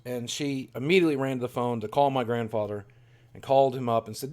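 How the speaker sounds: ordinary delivery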